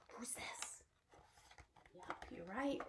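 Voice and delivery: hushed question; whisper. Real, not acted. Teacher whispering to children before the next animal. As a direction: whisper it like a secret.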